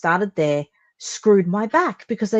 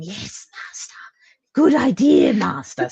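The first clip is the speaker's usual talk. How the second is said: whispered servile hunchback voice; Igor voice. Retold or imitated. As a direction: hiss it like a groveling lab assistant. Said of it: "walk around like Igor going".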